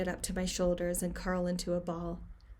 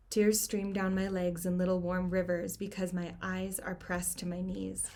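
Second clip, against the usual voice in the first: usual speaking voice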